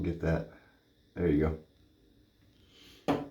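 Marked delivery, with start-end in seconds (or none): none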